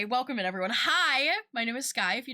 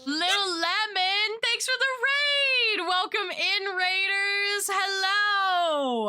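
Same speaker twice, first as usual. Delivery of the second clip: high, bright streamer greeting; cute anime streamer; 10 semitones higher, 4 dB louder, slower than usual. Acted. Greeting raiders excitedly.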